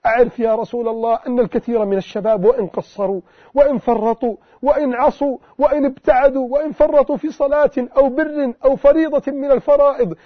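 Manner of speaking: ordinary delivery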